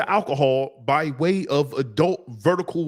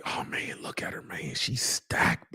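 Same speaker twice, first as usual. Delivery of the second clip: awed whisper; 2 semitones lower, 9 dB quieter than usual. Retold or imitated. Whispering to his buddy as she walks by. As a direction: whisper like you can't believe your eyes.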